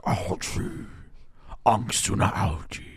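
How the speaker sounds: drawn-out, husky mock mummy voice; slow, voiceless croak of a pretend villain; Emotep. Joking that Emotep was thinking algae, slow mock mummy voice. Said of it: "He's like".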